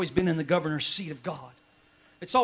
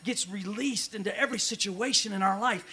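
ordinary talk

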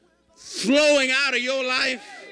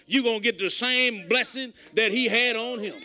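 ordinary talk, nothing marked

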